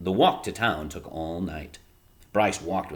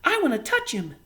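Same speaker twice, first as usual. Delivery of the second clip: put-on much higher little kid voice; small child. A narrator's reading. Small child calling mother about the bunny, tiny high voice. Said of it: "said a small child".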